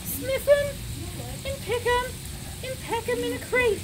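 usual speaking voice

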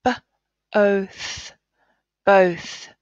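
"B, oath" split, then "both" blended; sounding out. Shown as a demonstration. Teacher blending the word both for pupils. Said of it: "Let's read these sounds".

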